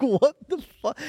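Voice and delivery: high, baffled what the fuck; tired worker's mock shock; stunned disbelief. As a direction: sound floored and half laughing. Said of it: "I was like".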